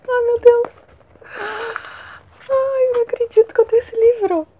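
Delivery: high-pitched, overjoyed exclaiming; real overwhelmed delight; thrilled disbelief. Real, not acted. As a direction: squeal it like a fan who can't believe it. Overjoyed at receiving a longed-for book.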